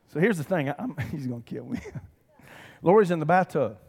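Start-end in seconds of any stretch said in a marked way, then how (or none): none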